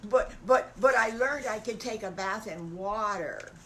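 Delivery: stammered, emphatic protest; eager self-defence. Acted. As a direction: jump in to defend yourself. Wolf hurries to defend himself.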